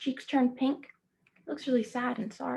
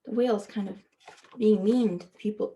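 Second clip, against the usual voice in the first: usual speaking voice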